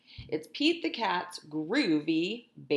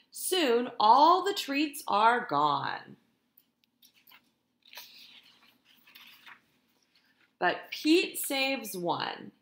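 lively, sing-song storytime reading; animated picture-book narration; reading to children; 4 semitones higher, 5 dB louder, much slower than usual. A narrator's reading. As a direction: read it like a teacher to her class. Teacher reads picture book aloud.

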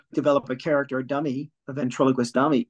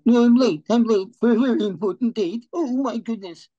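high, loud frantic rabbit; panicked cartoon rabbit; 8 semitones higher, 5 dB louder than usual.

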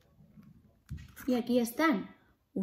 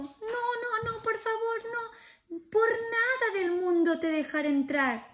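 much higher pleading piggy voice, half sung; squeaky little pig; 11 semitones higher than usual. Acted. Little pig refusing the wolf at the door, high and sing-song. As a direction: squeal it out in a tiny frightened voice. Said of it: "Y el cerdito dijo".

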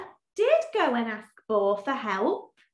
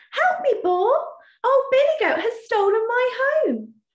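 pleading Badger; 11 semitones higher, 4 dB louder than usual. Badger begs Boar.

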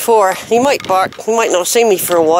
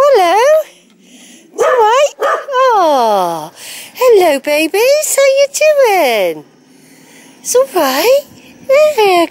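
talking to dogs; 10 semitones higher, slower than usual. Real, not acted. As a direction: coo at the dogs like they're babies. Cooing to dogs in kennel.

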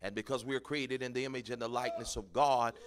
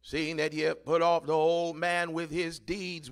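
preached repeat; 5 semitones higher, 7 dB louder than usual. Acted. Pastor repeats verse loudly.

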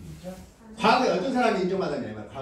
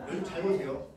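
ordinary talk

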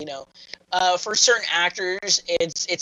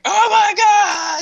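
high, loud frightened yelp; hammed-up panicked scream; scared shriek; 6 dB louder, much slower than usual. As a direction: shriek it like you just got caught. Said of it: "he just runs away all scared".

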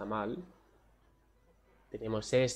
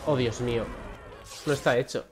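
ordinary talk, nothing marked

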